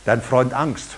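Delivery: lightly voiced mock menace; fear personified. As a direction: play Fear as a smug old acquaintance. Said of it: "wird die Angst dich anschauen und wird sagen".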